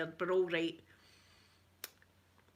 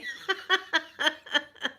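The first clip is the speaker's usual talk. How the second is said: laugh; 8 semitones higher, 3 dB louder than usual. Real, not acted. Laughs at her own excitement.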